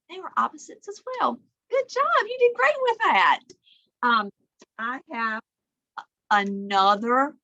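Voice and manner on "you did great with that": plain reading voice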